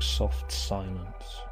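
usual speaking voice

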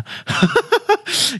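short high-pitched laugh. Real, not acted. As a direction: burst out laughing. Loud laugh alone on line.

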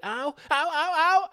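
high, loud, repeated "ow" yelps; mock yelps. Imitating woman in road rage video, mock yelps. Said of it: "You hear her going".

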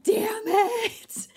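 high, whiny, disappointed yelp; mock frustrated cry. [name]'s fan missing the conversation, a high mock wail. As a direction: wail like you just missed the best part. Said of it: "I'm like".

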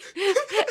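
sudden high-pitched giggle. Real, not acted. Host cracks up mid-story, high giggle. Laughing at the friend's joke.

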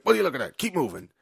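mock-aggressive, high and loud at the start; acted guard challenge. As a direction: imitate a guard moving someone on. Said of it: "your man's like".

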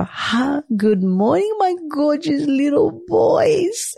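high, slow cooing to the babies; imitating husband's baby talk. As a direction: coo like a dad greeting his boys. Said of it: "he'll go".

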